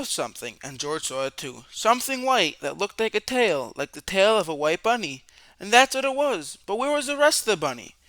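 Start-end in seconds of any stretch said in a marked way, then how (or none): 5.6-7.9 higher, faster, louder; excited discovery. A narrator's reading. Narrator reads finding the baby bunny, fast and excited. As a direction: rush with excitement.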